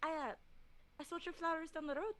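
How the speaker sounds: shy customer voice; soft shy character. Acted. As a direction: speak gently and unsure to the shopkeeper. Player voicing her character.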